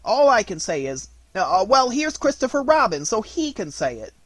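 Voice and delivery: high, loud, stammering relief; bluster, then hand off eagerly; rising, hurried fluster; flustered Rabbit. Acted.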